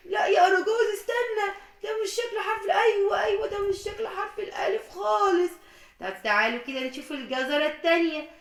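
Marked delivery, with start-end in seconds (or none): 0.1-1.5 alarmed shout. Acted. Puppet stops the other in alarm. 1.8-5.5 upset protest. Acted. Puppet protests the wrong letter.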